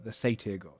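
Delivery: ordinary talk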